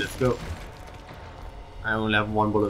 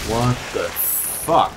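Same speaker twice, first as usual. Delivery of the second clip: stunned disbelief; much slower than usual. Real, not acted. Enemy suddenly dies.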